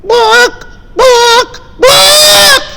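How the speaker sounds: storyteller voicing a chicken for children, high squawks. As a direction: squawk it like a hen calling out. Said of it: "the chicken one day was going".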